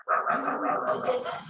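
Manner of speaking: imitating a nagging tirade in nonsense syllables; half-serious mimicked telling-off; mock yelling. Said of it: "Cindy's yelling at me".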